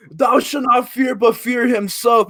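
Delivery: high, slow, grand declaiming; hammed-up scripture-like pronouncement; mock proclamation. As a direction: imitate a pompous orator, stretching it out. Quoting character's grandiose proclamation.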